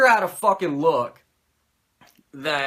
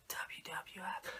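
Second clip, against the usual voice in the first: breathy whisper of the initials; whispered aside; 22 dB quieter, slower than usual. Retold or imitated. Tossing off the name of the rival promotion in a whisper. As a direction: drop to a whisper.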